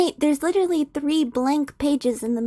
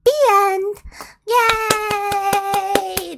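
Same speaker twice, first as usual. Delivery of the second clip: high, gleeful announcement; celebratory cheer; 6 semitones higher, much slower than usual. Real, not acted. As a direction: cheer with a long held final word. Celebrates finishing the reading.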